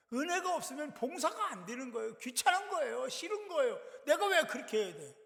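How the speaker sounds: put-upon, complaining inner voice; reluctant complaint. Acted. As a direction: voice a churchgoer balking at having to serve. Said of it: "이런 생각 드는 거죠".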